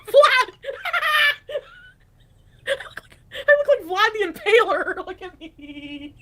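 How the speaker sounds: shrieking with laughter; cracking up mid-sentence; laughing hard. Real, not acted. Laughing at own wig look.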